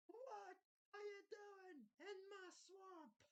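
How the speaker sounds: slow, drawn-out mock Scottish accent; bad Scottish accent. Reactor showing how poorly they do accents, bad Scottish accent. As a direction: attempt a Scottish accent, high and stretched out. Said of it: "can't even do a good Scottish accent".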